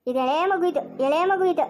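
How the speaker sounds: ordinary talk, nothing marked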